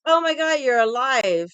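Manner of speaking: loud, high fake shock; mocking his loud surprised text; mock surprise. Mocking a friend's shocked text, loud and high. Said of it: "and he's like".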